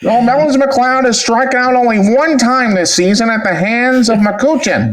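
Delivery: high, slow, booming baseball announcer; old-timey announcer. Acted. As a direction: announce the batter like the 1920s. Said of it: "old-timey announcer voice".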